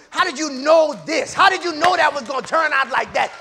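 acted incredulity, building in force; mock astonished questioning. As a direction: imitate onlookers demanding an explanation. Acts out people's astonished questions.

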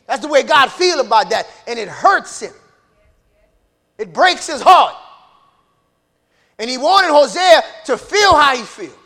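loud, emphatic sermon delivery; full-force preaching. Real, not acted. Preacher telling church how God hurts, forceful preaching. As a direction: drive each phrase home loudly.

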